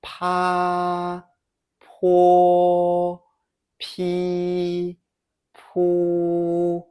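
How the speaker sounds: "Pa, po, pi, pu" each held high and level after a puff; aspirated "Pa, po, pi, pu" in drawn-out first tone; syllable drill. Shown as a demonstration. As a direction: sustain "Pa, po, pi, pu" evenly, pausing between each. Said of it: "Let's practice syllables".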